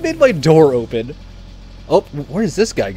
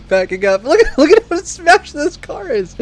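high, loud words through laughter; laughing hard, pitch climbing high; 12 semitones higher, 7 dB louder than usual. Real, not acted. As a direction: crack up while trying to talk. Cracking up at the wreck.

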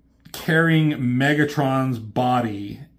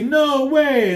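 high, loud defiance; high shouted robot refusal; defiant shout; 6 dB louder than usual. Retold or imitated. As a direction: yell it as a refusal. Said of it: "they say".

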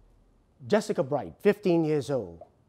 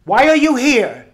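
high, loud séance summons; talking to a ghost; 9 semitones higher, 11 dB louder than usual. Acted. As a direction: call out to the spirit with loud, raised voice. Medium calling to the spirit.

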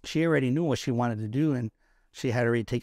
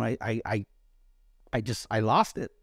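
usual speaking voice

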